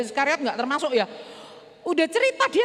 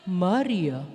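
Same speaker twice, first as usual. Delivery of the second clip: low, gentle calling of a name; deep tender call; Jesus calling; 9 semitones lower than usual. Acted. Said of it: "Persis banget cara manggilnya, intonasinya".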